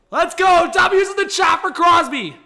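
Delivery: high loud shout to chat; rally the chat; hype shout. Real, not acted. Streamer hyping chat for his dog, high loud shout.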